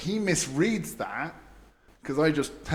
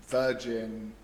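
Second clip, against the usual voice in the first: ordinary talk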